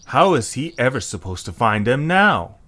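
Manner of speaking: plain reading voice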